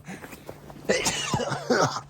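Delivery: sneezing. Real, not acted. Sneeze fit, then swearing.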